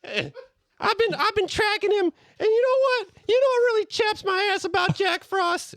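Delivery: tiny, high cookie voice; little voice. Acted. Gingerbread man popping out of the shadows, tiny high voice. Gingy ranting.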